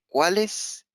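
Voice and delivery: ordinary talk, nothing marked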